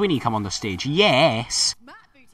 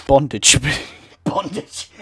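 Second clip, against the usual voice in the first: laughing through the word Bondage; 8 dB louder, slower than usual. Real, not acted. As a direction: giggle out Bondage. Laughing repeat of pun.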